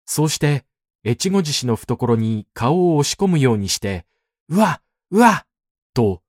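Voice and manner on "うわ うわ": higher, louder wails broken one by one; halting sobs. A narrator's reading. Man sobbing into the elder's chest, halting cries. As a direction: sob in separate bursts. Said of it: "声を一つずつ区切って泣き出した".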